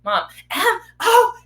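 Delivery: high, loud, drawn-out calling; nagging child. Retold or imitated. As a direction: imitate a kid pestering for attention. Child nagging for mother's answer.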